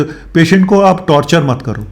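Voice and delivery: ordinary delivery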